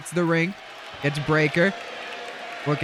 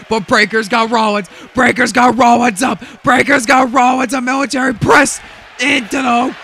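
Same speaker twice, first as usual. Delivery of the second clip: higher, louder rapid match call; hyped play-by-play; 7 semitones higher, 7 dB louder than usual. Real, not acted. Commentator calling a power slam and count, excited.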